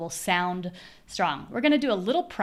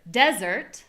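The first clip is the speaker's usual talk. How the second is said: "desert" with a loud DES; strong first syllable, weak "ert" in "desert"; first-syllable stress; 3 semitones higher, 5 dB louder than usual. Shown as a demonstration. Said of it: "My voice is higher in pitch".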